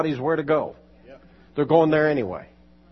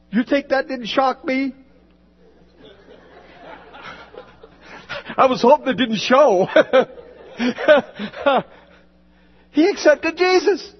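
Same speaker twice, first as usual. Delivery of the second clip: much higher, louder, thrilled; excited shouting; 12 semitones higher, 5 dB louder than usual. Real, not acted. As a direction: burst out with the surprise of it. Excited retelling, rising to shout.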